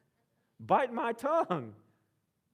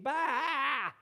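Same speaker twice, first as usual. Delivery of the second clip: sheep bleat; 5 semitones higher than usual. Pretend. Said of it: "That's my sheep impression".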